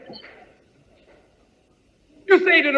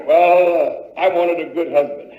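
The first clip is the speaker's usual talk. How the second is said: lower, fast, rueful reply; disappointed wife; 8 semitones lower than usual. Acted. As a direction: answer with a sigh of regret.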